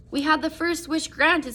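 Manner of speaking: feverish. A narrator's reading. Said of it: "said the old woman feverishly".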